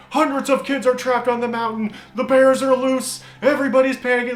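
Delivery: high, loud sensational bulletin; hammed-up breaking news panic; alarmist news reporter. News crews reporting on kids trapped on the mountain, alarmist reporter. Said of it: "news vans were already up there reporting".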